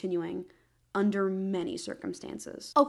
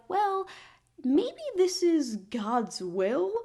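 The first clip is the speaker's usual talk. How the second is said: hammy pious; 7 semitones higher, 4 dB louder, much slower than usual. Retold or imitated. Priests voiced rationalising.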